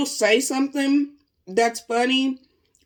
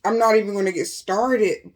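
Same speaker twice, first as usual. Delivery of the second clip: ordinary talk, nothing marked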